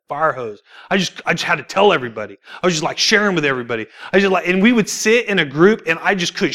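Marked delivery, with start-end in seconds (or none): none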